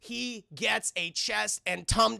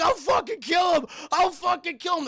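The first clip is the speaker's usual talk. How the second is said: much higher, loud frantic scream; screaming threat; 10 semitones higher, 6 dB louder than usual. Retold or imitated. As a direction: scream it like a cornered man. Hostage-taker threatening the cops.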